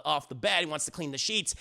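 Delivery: ordinary talk, nothing marked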